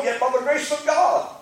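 ordinary talk

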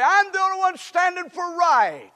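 high, loud, acted-out grievance; mock-aggrieved cry; self-pitying complaint. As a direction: wail it high, as the lone righteous one. Voices a self-pitying believer.

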